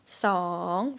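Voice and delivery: song dips low and rises; Thai rising tone. Shown as a demonstration. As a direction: scoop song down then up. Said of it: "rising tone".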